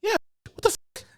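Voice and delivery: high-pitched disbelief at the nurse; incredulous outburst. Retold or imitated. Telling a story about the nurse, high incredulous mock outrage. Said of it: "I'm like".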